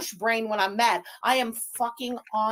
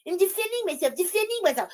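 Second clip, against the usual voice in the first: high, singsong parroting; mocking mimicry; 7 semitones higher, faster than usual. Host parroting her defending herself, mocking. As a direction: mimic her excuse high, twice over. Mimicking the woman's repeated excuse.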